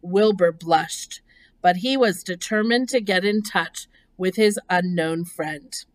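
usual speaking voice

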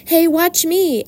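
high, excited show-off call; boyish yell. A narrator's reading. Boy shows off at the crate, yelling high. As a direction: yell it out for attention. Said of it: "yelled Avery".

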